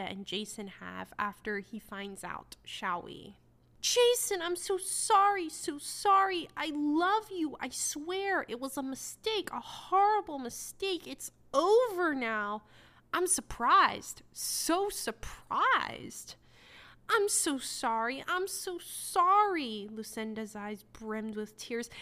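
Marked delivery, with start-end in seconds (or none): 3.8-12.6 high, overwrought mock plea; a hammed-up sorry-sorry plea, pitched high; mock pleading cheater. Mock reading cheater's pleading lines. 13.1-16.4 mock surprise. Mocking the book's dialogue. 17.1-19.9 mock pleading. Mock reading Lucinda's apology.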